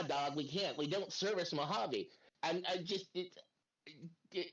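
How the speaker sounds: normal talking voice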